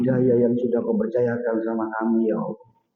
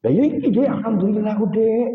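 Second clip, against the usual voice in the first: cheerful husband; 7 semitones higher than usual. Retold or imitated. Abu Nawas answers his wife.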